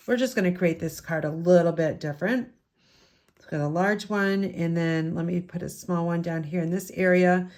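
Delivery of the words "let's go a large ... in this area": ordinary talk, nothing marked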